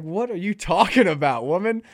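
loud, high disbelieving snap back; incredulous retort. Retold or imitated. Scoffing back at a friend's conspiracy talk, loud disbelief. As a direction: blurt out disbelief at her nonsense.

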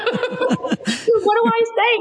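very high, stretched-out question through laughter; retold clueless plea, cracking up; laughing helplessly. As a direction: squeal the question while laughing. Laughing at her own retold cluelessness.

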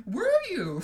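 high, loud, heated retort; acted indignant challenge; hotly. A narrator's reading. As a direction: fire back hotly, pitch leaping up. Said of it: "said Professor McGonagall hotly".

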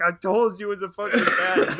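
loud, high-pitched mock yelling; exasperated triumph, acted out; vindicated outburst. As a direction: shout it as the man finally proved right. Vindicated passenger in retold climax.

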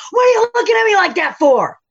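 high, exasperated snapping, reenacted; retold outburst. Server retelling snapping at the Postmates man, high exasperated outburst. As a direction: replay losing your temper at him. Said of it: "screamed at him".

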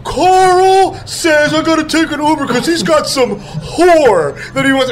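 high, slow whiny tattletale voice; mock whiny complaint. A dude tattling to the boss about Carl, high and whiny. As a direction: whine it way up high and drawn out like a tattling kid. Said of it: "He's like".

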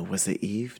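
normal talking voice

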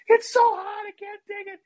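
put-on very high, whining childish voice; whiny kid complaint. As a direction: wail like a kid with a burnt mouth. Brother complaining about spicy chili.

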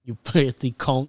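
Michael Caine. Acted. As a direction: draw it out in mock British. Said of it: "Michael, Michael Caine".

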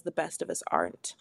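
soft unvoiced whisper; whispered aside. Shown as a demonstration. Reading the fine print to listeners in a whisper. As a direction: whisper it like a secret.

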